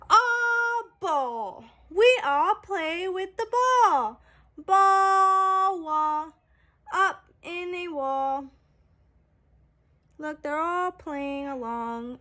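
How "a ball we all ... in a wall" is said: bright, sing-song picture-book reading; reading to children. A narrator's reading. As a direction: read it out like a storybook for little kids. Book text read aloud.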